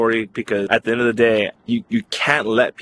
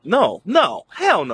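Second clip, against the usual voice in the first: high, emphatic string of refusals; defiant refusal; 9 semitones higher, slower than usual. Retold or imitated. Student refusing to accept the rejection, defiant refusal. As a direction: push the pitch up and refuse.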